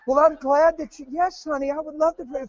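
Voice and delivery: put-on gentle prayer-minister voice; soft and gentle. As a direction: coo it sweetly to set up the joke. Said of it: "you're real soft and gentle".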